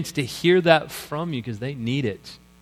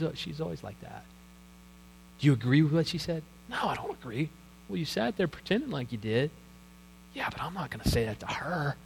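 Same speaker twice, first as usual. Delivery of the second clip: much softer, hushed backroom gossip; hushed voices after the meeting; whispered gossip; 10 dB quieter, faster than usual. Retold or imitated. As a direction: murmur it like you're scared to be overheard. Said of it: "it's usually in a whispered voice".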